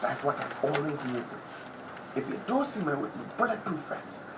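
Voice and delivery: normal talking voice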